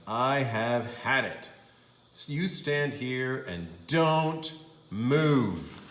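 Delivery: mildly exasperated parent; fed-up father. Acted. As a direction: lay down the law slowly. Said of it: "her father said".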